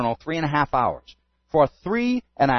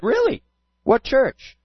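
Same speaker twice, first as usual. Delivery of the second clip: surprised; 8 semitones higher, slower than usual. Retold or imitated. Woman surprised he's a pastor.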